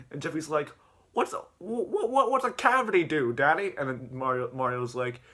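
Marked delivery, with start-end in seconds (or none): 1.1-3.7 put-on high, stammering kid question; put-on high puzzled kid; kid character voice. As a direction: ask Daddy in a high child's voice. Voicing Jeffy questioning his dad.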